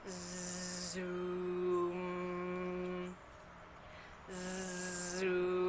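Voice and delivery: low, soft, steady humming zoom; a quiet, long lawnmower hum. Shown as a demonstration. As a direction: drone it low and steady like a mower. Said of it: "It goes like this".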